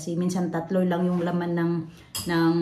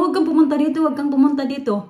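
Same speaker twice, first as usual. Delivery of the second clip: raised mock shout of a kid, higher; excited kid yelling higher and louder; child calling out; 8 semitones higher, 6 dB louder, faster than usual. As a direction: call down the stairs to keep mom away.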